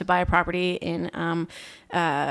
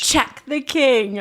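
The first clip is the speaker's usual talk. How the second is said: hammed-up British film line, drawn out; Harry Potter impression; 5 semitones higher, much slower than usual. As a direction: declare it grandly and slowly, pitched up. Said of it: "I need to watch Harry Potter".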